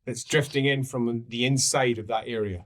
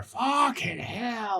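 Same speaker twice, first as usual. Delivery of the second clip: dismayed guard; 9 semitones higher, 3 dB quieter, much slower than usual. Acted. Guard sees the smoke.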